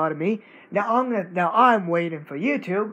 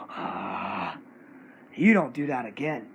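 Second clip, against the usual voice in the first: usual speaking voice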